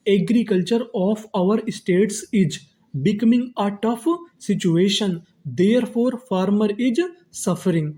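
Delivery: very slow, separated dictation-style reading; slow word-by-word reading. Shown as a demonstration. Teacher reading the built sentence to students, slow and word by word. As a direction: read the sentence slowly, pausing between words. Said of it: "तो पूरा हमारा sentence क्या बना".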